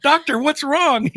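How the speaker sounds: hammed-up worried wife asking the doctor; worried woman. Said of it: "And she's like".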